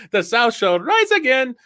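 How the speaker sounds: high, slow mock Indian accent; Apu. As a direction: declare it in a cartoon Indian accent. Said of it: "Apu comes out with a Confederate uniform on".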